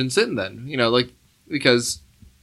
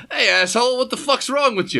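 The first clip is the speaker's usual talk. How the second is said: mock gangster yell; 8 semitones higher than usual. Gangster pacing scene retold.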